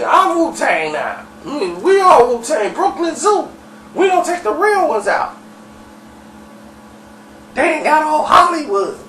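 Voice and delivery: high-pitched ranting boast; strained high holler, proclaiming himself; flipping out. Retold or imitated. Dirty declaring himself the Wu-Tang, flipping out. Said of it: "Dirty was flipping out".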